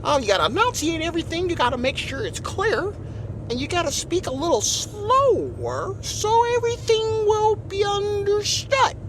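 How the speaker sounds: high, over-enunciated proper speech; non-threatening code-switch voice. Shown as a demonstration. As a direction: enunciate everything in a high, harmless voice. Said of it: "This is the voice where I don't sound like a threat".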